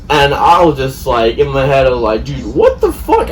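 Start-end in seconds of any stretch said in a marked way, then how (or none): 2.2-3.3 high, loud disbelieving outburst; retold exasperation. Retold outraged inner reaction.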